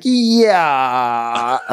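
a fake secret aside in full voice; mock T J. Cohost imitating T J's hand-over-eyes aside, high mock yeah.

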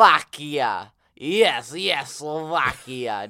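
put-on loud, slow Slovak-accented chant. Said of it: "chanting my Slovakian accent".

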